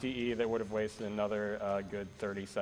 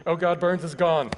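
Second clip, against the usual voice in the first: loud sudden panic; alarmed; 9 semitones higher, 14 dB louder than usual. Real, not acted. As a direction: blurt it out in shock. Runner loses NPC mid-run.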